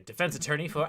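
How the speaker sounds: usual speaking voice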